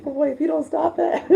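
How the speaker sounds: high-pitched, laughing mom reprimand; laughing mock scolding. Mother retells telling her son to stop, laughing mock scolding.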